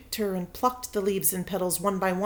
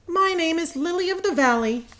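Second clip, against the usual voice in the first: put-on high proud little girl voice; eager girl; 9 semitones higher, 4 dB louder than usual. Victoria claiming a flower name, high. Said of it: "said Victoria".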